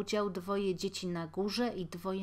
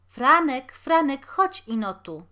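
loud high call across the yard; 5 semitones higher, 9 dB louder than usual. A narrator's reading. Mother calling her son from the garret window: loud call. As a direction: shout the name up and out.

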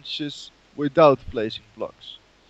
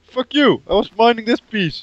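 loud, high, annoyed yell; angry shout; 6 semitones higher, 4 dB louder than usual. Real, not acted. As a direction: snap back furious at a teammate. Friend broke his block.

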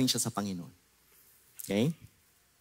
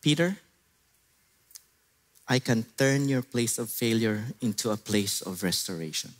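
soft, lower, reassuring voice of Jesus; gentle Jesus; 4 semitones lower, 3 dB quieter than usual. Acted. Jesus reassuring Peter his failure is not final, softly. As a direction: speak restoration gently.

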